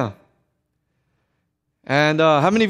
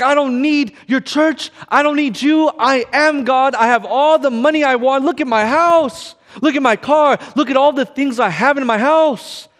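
higher-pitched, emphatic boasting; defiant boast; 4 semitones higher than usual. Retold or imitated. Drug dealer bragging to the outreach worker, defiant. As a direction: rant like a cocky drug dealer showing off.

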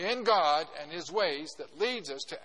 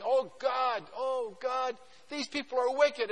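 higher, louder pleading prayer; Noah praying; 7 semitones higher, 5 dB louder, slower than usual. Retold or imitated. Pastor voicing Noah begging God about wicked people, higher and louder. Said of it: "He's praying".

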